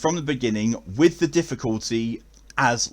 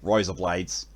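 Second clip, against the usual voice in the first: Aussie "Razor blades", first vowel widened to "rye"; Australian accent; 3 semitones lower, 4 dB quieter, slower than usual. Shown as a demonstration. Testing an Australian accent, "Razor blades" with a "rye" vowel. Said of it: "Not for an Australian accent".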